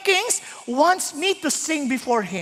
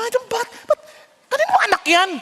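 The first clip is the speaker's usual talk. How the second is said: annoyed onlooker; 7 semitones higher than usual. Acted. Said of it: "kung hindi mo anak yun".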